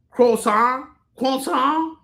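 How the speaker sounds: high, loud Frenchified "croissant"; mocking, questioning French pronunciation; mock French accent. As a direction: mimic a pretentious French kwah-sahn. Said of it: "pronounce everything like he's French".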